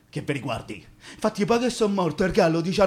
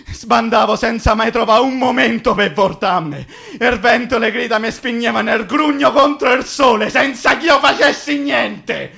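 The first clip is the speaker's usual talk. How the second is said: impeto disperato; 6 semitones higher, 5 dB louder than usual. Acted. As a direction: build to a high, loud cry. Morto sbattuto da vento e grida. (grido acuto e disperato in crescendo)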